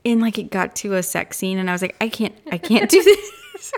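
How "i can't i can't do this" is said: high, loud protest breaking into laughter; laughing protest. Retold or imitated. Retelling quitting an awkward audiobook scene, laughing protest. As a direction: give up mid-sentence, cracking up.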